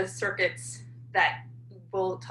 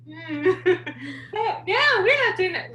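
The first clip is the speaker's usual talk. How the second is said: high, loud, laughing through the words; laughing mock denial; 11 semitones higher, 5 dB louder, much slower than usual. Real, not acted. Lecturer jokes to class about US colonies, laughing mock denial. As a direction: burst into a laugh on a joking denial.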